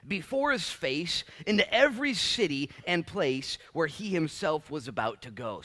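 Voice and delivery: loud, high, measured Bible reading; scripture reading. Acted. Preacher reads the passage to the congregation, proclaiming. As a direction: proclaim each phrase slowly at the start, then press on.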